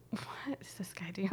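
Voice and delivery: unvoiced whisper, puzzled; whispered bewilderment. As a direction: breathe it as a baffled onlooker. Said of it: "everybody's reactions to him".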